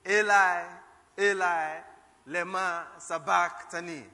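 loud cry. Acted. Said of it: "we're going to study in Hebrew".

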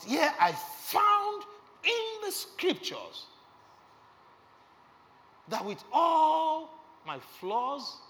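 high, loud, slow preaching build; preaching at full force. Acted. Preacher tells congregation God loves him despite flaws, high and loud, slow. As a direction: proclaim it slowly, rising high. Preaching climax on God's love.

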